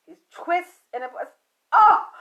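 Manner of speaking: pained cry. Retold or imitated. Mother on the phone cries out in pain. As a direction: cry out as the pain twists inside. Uterus twisting pain retold.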